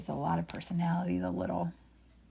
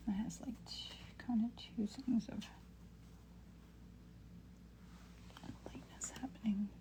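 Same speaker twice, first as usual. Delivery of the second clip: whispering; 3 semitones higher, 12 dB quieter than usual. Real, not acted. Muttering to herself while painting.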